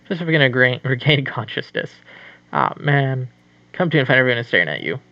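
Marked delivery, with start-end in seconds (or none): none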